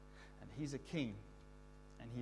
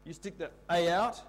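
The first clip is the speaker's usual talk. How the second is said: ordinary talk, nothing marked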